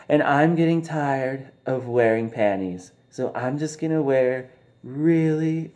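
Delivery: high, slow, sly tease; mock teasing wife. Wife taunting her husband through the daughter, high slow tease. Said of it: "Naomi's all like".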